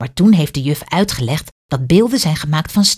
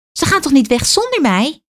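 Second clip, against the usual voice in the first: put-on high, alarmed child's question; scared the bus leaves without her; startled worry; 10 semitones higher than usual. A narrator's reading. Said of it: "Kaat schrikt".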